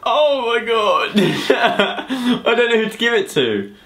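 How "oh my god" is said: ordinary talk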